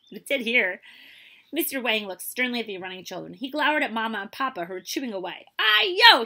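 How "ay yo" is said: high, very loud exclamation; crying out. A narrator's reading. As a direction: exclaim at full voice. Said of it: "he cried".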